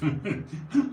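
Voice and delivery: short burst of laughter; amused laugh at own attempt. Real, not acted.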